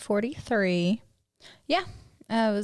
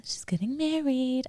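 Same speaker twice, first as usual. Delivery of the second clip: whisper; 7 semitones higher, slower than usual. Real, not acted. Secret news aside.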